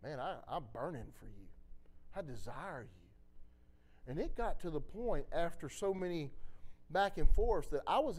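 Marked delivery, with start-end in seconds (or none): 0.0-4.1 much softer, lower and gentle; hushed, tender reassurance; tender voice of God. Retold or imitated. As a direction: speak it like a loving whisper of comfort. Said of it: "he just kept telling me".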